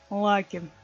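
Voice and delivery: ordinary delivery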